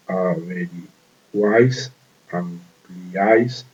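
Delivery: each example word drawn out slowly. Shown as a demonstration. Teacher slowly sounding out averiguáis and ampliáis as examples. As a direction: spell out the stressed vowel by stretching it.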